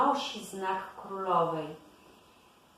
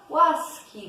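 higher, louder plea for mercy; pleading; 6 semitones higher, 6 dB louder than usual. A narrator's reading. Śnieżka begs the queen's side for mercy, raised pleading cry. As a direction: cry out one pleading word, as a storyteller would. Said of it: "błagała Śnieżka".

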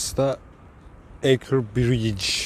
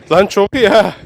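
thrilled; 8 semitones higher, 7 dB louder than usual. Real, not acted. Excited at finding the sea path.

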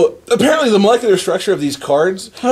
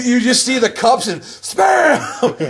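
laughing; 7 semitones higher, slower than usual. Real, not acted. Laughing while retelling the duel.

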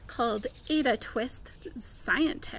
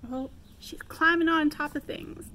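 ordinary delivery, nothing marked

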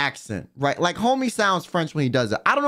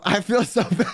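higher, breaking into real laughter; laughing; 6 semitones higher than usual. Real, not acted. Streamer feeling bad for the Uber driver, laughing. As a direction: say it through a real laughing fit.

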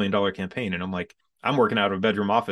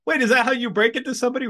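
much higher, louder and fast, half-joking disbelief; incredulous outburst; 6 dB louder, faster than usual. Retold or imitated. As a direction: fire it off at the nurse in comic disbelief. Retelling nurse's blunt triplets news.

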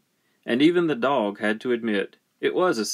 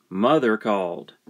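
usual speaking voice

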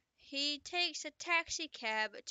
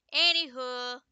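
usual speaking voice

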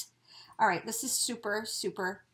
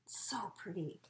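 whisper; 4 semitones lower, 14 dB quieter than usual. Real, not acted. Whispered while opening package.

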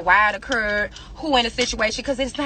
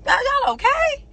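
much higher, sing-song put-on voice; mocking concern. Real, not acted. Taunting the haters with a high mocking voice. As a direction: fake concern in a squeaky mock tone.

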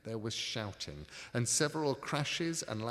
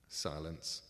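usual speaking voice

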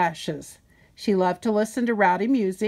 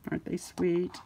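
soft aside; 2 semitones lower, 8 dB quieter than usual. Real, not acted. Reader softly shows the child the picture. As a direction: point it out softly.

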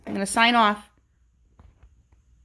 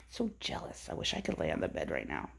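quiet aside; 14 dB quieter than usual. Real, not acted. Aside while watching dogs.

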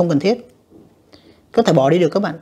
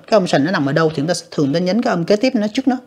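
usual speaking voice

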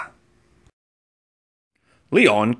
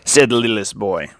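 ordinary delivery, nothing marked

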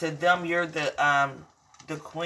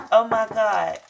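excited; 4 semitones higher, 6 dB louder, slower than usual. Real, not acted. Excited outburst between sung lines.

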